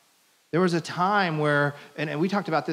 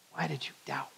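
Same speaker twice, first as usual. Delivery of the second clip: hushed question; 11 dB quieter than usual. A narrator's reading. Preacher reading Jesus' words to Peter, hushed. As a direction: let the question drop almost to nothing.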